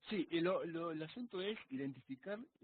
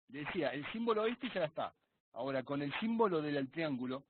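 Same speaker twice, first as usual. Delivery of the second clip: raised, projected explaining voice; speaking up; 8 dB louder than usual. Real, not acted. Student resuming his question after going unheard, speaking up. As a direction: project loudly over a poor connection. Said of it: "ahí no te escucho".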